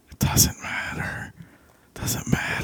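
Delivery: soft whispered repeat. Acted. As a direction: whisper it to yourself.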